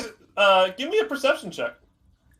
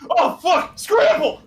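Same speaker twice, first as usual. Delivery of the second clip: startled yell; 13 semitones higher, 5 dB louder than usual. Acted. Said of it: "they're just like".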